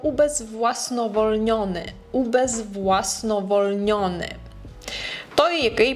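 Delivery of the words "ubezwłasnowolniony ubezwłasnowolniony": 1 slow careful. Shown as a demonstration. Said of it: "Kolejne słowo".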